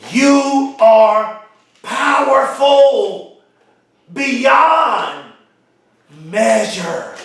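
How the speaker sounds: slow, word by word with long pauses; said slowly. Shown as a demonstration. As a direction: let each word land, very slowly. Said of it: "I want to say that slowly".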